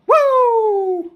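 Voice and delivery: very high, loud, sustained "woo"; piercing drawn-out whoop; excited whoop. Real, not acted. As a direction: holler a long woo to sign off. Whoops as he signs off.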